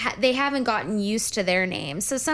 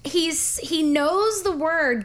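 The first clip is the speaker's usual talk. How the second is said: ordinary talk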